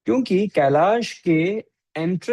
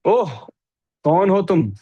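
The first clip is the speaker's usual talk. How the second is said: raised, sneering challenge; insulting, crooked tone, pitched higher; disrespectful tone; 4 semitones higher, 4 dB louder than usual. Acted. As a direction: demand it with open disrespect. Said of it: "टेढ़ी tone से किसी से बात करते हो".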